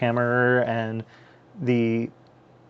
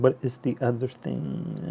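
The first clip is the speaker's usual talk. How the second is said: muttered to himself; 8 dB quieter than usual. Real, not acted. Repeats question quietly to himself.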